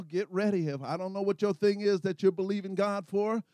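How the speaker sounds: ordinary talk, nothing marked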